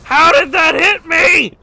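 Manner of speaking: high, slow, disbelieving shout; outraged yell. Real, not acted. Outraged at the hit.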